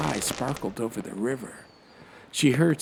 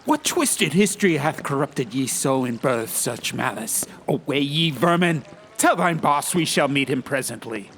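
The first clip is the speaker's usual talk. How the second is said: archaic rebuke; 5 semitones higher, 4 dB louder than usual. Acted. Fen drives ruffians away.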